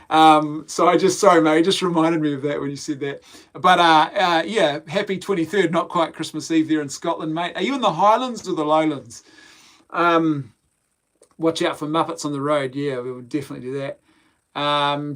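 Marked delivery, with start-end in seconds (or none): none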